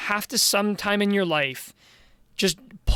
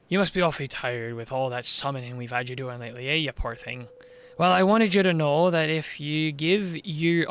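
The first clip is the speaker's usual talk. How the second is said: a lightly voiced Welsh village mayor, low and coaxing; scheming mayor charming a girl, low Welsh lilt; 3 semitones lower than usual.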